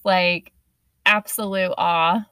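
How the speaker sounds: ordinary delivery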